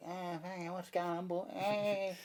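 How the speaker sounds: high, soft caricature of an Aussie bloke; mock Aussie guy. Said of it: "this Aussie guy, like".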